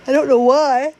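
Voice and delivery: high, loud words through a yawn. Real, not acted. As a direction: push it out mid-yawn. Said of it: "started to yawn".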